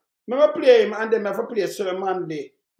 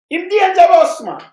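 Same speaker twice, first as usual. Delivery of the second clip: loud, high, heated declaration; angry outburst; 7 semitones higher, 9 dB louder than usual. Real, not acted. Commentator ranting to his viewers about the politician, loud and high. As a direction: blast it out at the top of your voice.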